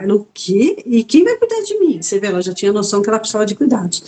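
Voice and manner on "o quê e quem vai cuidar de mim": mock-indignant mother, pitch leaping up; retold alarmed question. Mother objects to living alone, indignant and alarmed. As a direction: protest in a high, incredulous voice.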